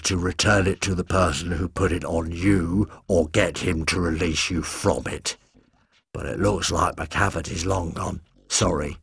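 low, soft, regretful monster voice; a gentle beast sorry for what it must do; apologetic beast Kevin. A narrator's reading. Kevin the beast apologising to the Doctor, low soft regret.

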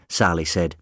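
ordinary delivery

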